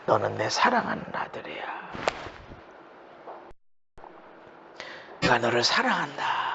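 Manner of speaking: low, soft, gentle assurance; tender voice of God. Acted. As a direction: speak as a loving father.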